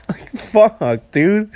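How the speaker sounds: words squeezed out between real laughs; laughing. Real, not acted. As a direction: struggle to talk while cracking up. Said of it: "I'm sorry for laughing".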